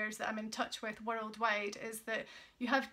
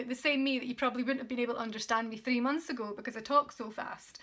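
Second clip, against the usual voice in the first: rapid, rushed speech; fast talking; faster than usual. Shown as a demonstration. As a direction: rattle it off quickly. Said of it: "as you can tell".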